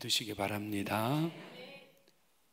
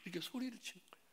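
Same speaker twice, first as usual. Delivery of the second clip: fast, high, softer aside; quick aside; 7 semitones higher, 7 dB quieter, much faster than usual. Real, not acted. Preacher tells congregation how mother yelled, quick soft aside. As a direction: toss it off quickly and quietly, pitched high. Repeats the tag, quick and hushed.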